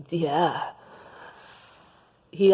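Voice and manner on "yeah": low voice. Acted. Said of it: "Lowest Voice Possible Johnson".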